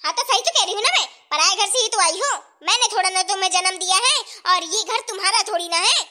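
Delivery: loud, combative rebuttal; indignant mother-in-law putting her down; angry retort. Acted. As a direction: hit back hard, leaving no room to argue. Mother-in-law argues back at her.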